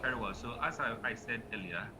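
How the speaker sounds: faint, distant voice far from the mic; barely audible. Real, not acted. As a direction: speak as if off the microphone. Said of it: "Your voice is very low".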